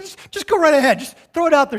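high, eager mock insistence; eager pleading. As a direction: brightly push her to send it. Pastor urging wife.

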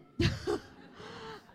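high burst of laughter; real laugh. Real, not acted. Speaker admits to the audience she didn't believe it, laughing. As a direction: laugh at your own confession.

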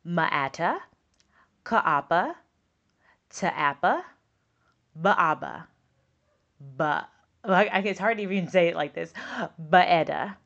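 "ma'ata", "ka'apa", "ta'apa", "ba'aba", "ba'eda" read with an extra uh sound between the letters; schwa-added sounds. Retold or imitated. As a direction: sound out each word adding an uh after every consonant, like the child did. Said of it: "these are some of the things that she read".

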